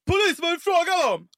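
high-pitched, forceful challenge; imitating a cop calling out; mock police shout. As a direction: burst in like an officious cop. Imitates cop flashing badge.

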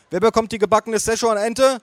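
waitress. Acted. Waitress voiced by narrator.

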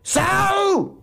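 very high, drawn-out yell; loud snort of abuse. Acted. Klaus abusing Paul, loud. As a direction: howl the insult out long. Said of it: "schnaubt Klaus laut".

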